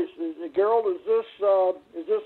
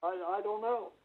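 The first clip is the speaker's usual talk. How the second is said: distant echoey phone voice; "I, I don't know" said away from the phone; phone held away; 10 dB quieter, faster than usual. Real, not acted. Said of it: "Now you're talking in an echo".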